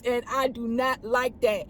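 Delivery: ordinary talk, nothing marked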